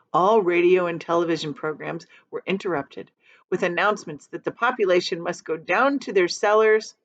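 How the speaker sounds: usual speaking voice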